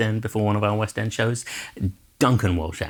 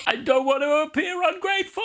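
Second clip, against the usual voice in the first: high yell over the wind; shouting mid-skydive. Retold or imitated. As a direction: yell it while falling. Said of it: "I jumped out of the plane saying".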